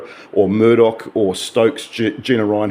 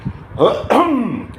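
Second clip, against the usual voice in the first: a quick throat clear before restarting; 13 semitones higher than usual. Real, not acted. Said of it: "cleared my throat".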